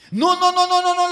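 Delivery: high, loud, panicked no-no-no; frightened pleading. Acted. The people to Moses, pleading loudly in fear. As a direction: beg in terror. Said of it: "le dijo el pueblo a Moisés".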